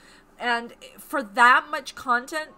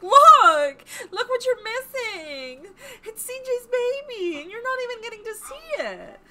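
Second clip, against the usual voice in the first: mock pleading; 12 semitones higher, 7 dB louder than usual. Wife nagging husband to see friend's baby, exaggerated high plea. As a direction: open loud, then stretch it out high and insistent. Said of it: "I have to constantly be like".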